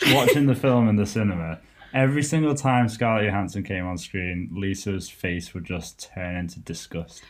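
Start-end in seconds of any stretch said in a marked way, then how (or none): none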